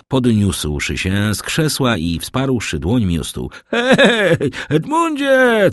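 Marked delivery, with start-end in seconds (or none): 3.7-5.7 high, loud drawn-out call; shouting. A narrator's reading. Drunk Kaderus hailing a friend who ignores him, high shout. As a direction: holler the name up high. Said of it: "krzyczał Kaderus".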